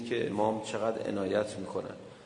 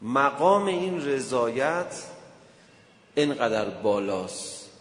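louder, slow sermon delivery; slow emphatic preaching; 3 dB louder, slower than usual. Acted. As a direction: drive the question home from the pulpit. (موعظهٔ کند و پرتأکید)

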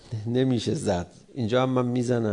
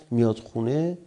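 ordinary delivery

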